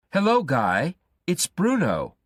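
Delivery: a pushy caller brightly greeting his reluctant friend; Bruno on the phone. A narrator's reading. Bruno calls Guy and greets him in a high, eager voice.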